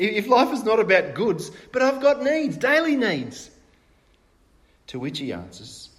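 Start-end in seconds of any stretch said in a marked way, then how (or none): 0.0-3.5 1 anxious protest. Acted. Disciple's worried protest continued.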